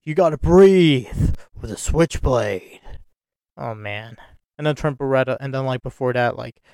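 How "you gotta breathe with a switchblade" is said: slow, drawn-out copy of his promo line. Retold or imitated.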